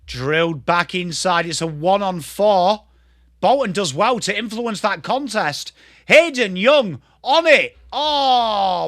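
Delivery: footy call building to an excited cry; live play-by-play. Real, not acted. Commentator calling Hayden Young's chance, building to excitement. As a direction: build from steady commentary to a high, loud peak.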